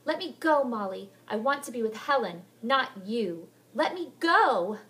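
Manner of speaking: loud, high, pleading demand; struggling girl. Acted. Heather fighting Molly to go to Helen. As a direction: fight to get free.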